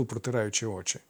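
plain reading voice

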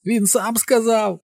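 very high, drawn-out accusing wail; high broken cry. A narrator's reading. Mike insists Henry admitted it, high broken cry. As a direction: voice pitched right up, breaking. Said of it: "закричав Майк високим зламаним голосом".

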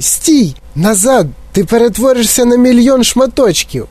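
high frantic pleading; frantic mother. Acted. Mother frantically calling her son back. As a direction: beg him to come back.

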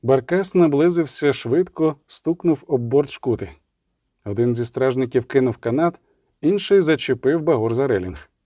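ordinary delivery

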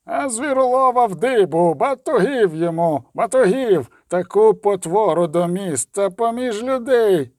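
high-pitched rabble-rousing cry; shouting craftsman. Acted. Craftsman in leather apron to the crowd, shouting. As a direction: call for punishment, voice climbing high. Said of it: "крикнув ремісник у шкіряному фартусі".

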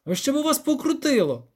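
high-pitched exasperated outburst; grumbled curse. A narrator's reading. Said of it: "буркнув Любисток".

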